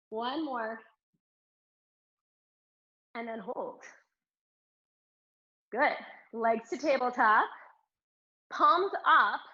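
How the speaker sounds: ordinary delivery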